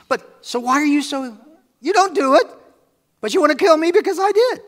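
loud, high-pitched indignant challenge; acted outrage, voice pushed high; indignant protest. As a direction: throw the accusation back at them, loudly. Jesus protesting threat to kill him.

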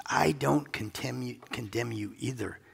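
slow and soft, fading to a hush; quiet slow reassurance to the woman; tender, slow. Acted. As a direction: let it sink in gently. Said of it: "Can you hear that?".

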